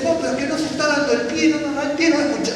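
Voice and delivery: a hint of an annoyed congregant; complaining worshipper. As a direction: protest that the leader gives no cue. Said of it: "Y entonces dice".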